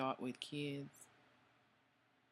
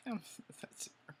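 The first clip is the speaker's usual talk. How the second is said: whispered laugh; 7 semitones higher, 5 dB quieter than usual. Real, not acted. Laughing at herself mid-story.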